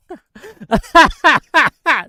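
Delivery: short high burst of laughter; amused laugh. Real, not acted. Laughs at being clipped.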